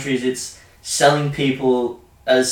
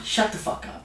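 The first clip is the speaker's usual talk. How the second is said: whispered retort; 7 semitones higher, 8 dB quieter than usual. Real, not acted. Laughing retort to friend's joke.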